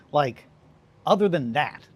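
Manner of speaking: normal talking voice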